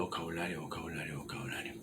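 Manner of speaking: starting soft and dying into a whisper; fading to a whisper. Real, not acted. Teacher urging students to study vocabulary, fading to a whisper. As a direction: let the repetition fade to nothing.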